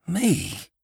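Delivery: high-pitched, startled "Me?"; cried out. A narrator's reading. Annie hearing she is chosen, crying out. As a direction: cry it in disbelief, pitched right up. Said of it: "cried Annie".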